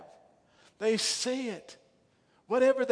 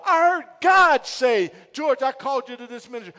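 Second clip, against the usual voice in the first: shouted testimony; 4 semitones higher, 9 dB louder than usual. Real, not acted. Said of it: "shouted testimony".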